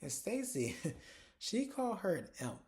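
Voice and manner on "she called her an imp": amused laughing. Real, not acted. Amused at the odd insult.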